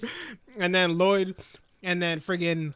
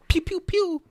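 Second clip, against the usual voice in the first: high, loud little pew noises; imitated pew-pew zaps; 13 semitones higher, 6 dB louder than usual. Girl striking pressure points, high mimicked zaps. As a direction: fire off playful high zaps. Imitated attack sounds in a recap.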